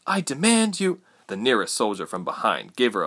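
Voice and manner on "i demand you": high-pitched indignant demand; demanding girl. A narrator's reading. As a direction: make a high-voiced demand of your captors.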